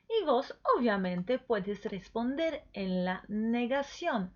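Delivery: ordinary talk, nothing marked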